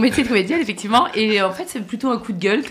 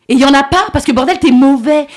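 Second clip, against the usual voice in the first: louder, heated outburst at the bad actor; angry rant; 5 semitones higher, 7 dB louder than usual. Comedian venting at a bad co-star, heated and loud. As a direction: let the anger spill over. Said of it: "je suis énervée".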